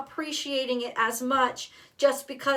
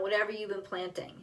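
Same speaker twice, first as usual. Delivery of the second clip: usual speaking voice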